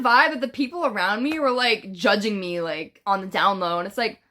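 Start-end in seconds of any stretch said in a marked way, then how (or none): none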